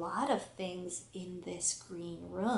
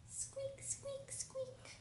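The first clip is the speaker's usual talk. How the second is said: mouse squeak; 15 dB quieter than usual. Pretend. Voicing the mouse.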